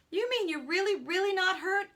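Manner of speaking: high, loud worried question; concerned. Acted. Boy asks the fallen man, high-pitched concern. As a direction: press for the truth in disbelief. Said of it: "I asked, concerned".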